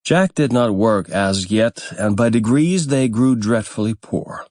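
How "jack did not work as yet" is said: ordinary delivery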